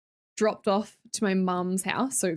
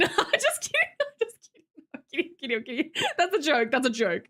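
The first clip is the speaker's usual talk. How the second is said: real laughter breaking into the words, pitch jumping high; giggly, higher-pitched disclaimer; laughing backtrack; 5 semitones higher than usual. Real, not acted. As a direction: laugh while hurriedly taking it back. Hastily backtracking on a risky joke.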